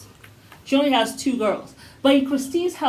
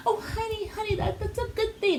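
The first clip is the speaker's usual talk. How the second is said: high, soft coddling mother voice; mammy mode; 9 semitones higher, 7 dB quieter than usual. Retold or imitated. Podcaster mocks Christine soothing Cody, high soft coo. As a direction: coo over him like a doting mammy. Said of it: "right into mammy mode of Cody".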